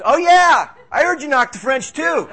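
loud, high, emphatic piling-on; retold eager agreement. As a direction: act out a friend loudly chiming in. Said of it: "and he goes".

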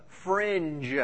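FRINGE with a soft voiced "nj" ending; careful FRINGE. Shown as a demonstration. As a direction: stretch out FRINGE, buzzing the final "dge". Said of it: "I said that shepherds were considered".

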